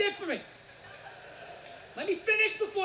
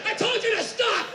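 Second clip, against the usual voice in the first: loud, high, laughing scold; mock scolding yell; 7 semitones higher, 5 dB louder than usual. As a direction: bark at the dieter through laughter. Chef scolding the dieter.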